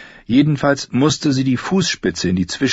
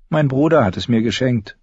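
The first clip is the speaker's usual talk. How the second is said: plain reading voice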